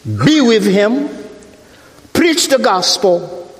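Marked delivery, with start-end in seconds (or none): none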